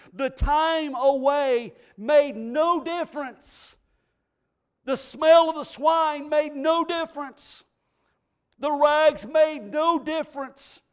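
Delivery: loud, ringing sermon climax with shouted peaks; impassioned pulpit shout; full-force preaching. Acted. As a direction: preach it out to the back pews. Preaching climax on father's love.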